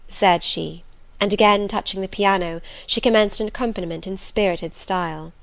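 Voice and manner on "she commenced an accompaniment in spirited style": ordinary talk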